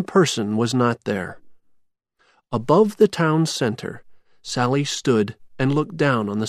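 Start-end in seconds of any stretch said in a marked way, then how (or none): none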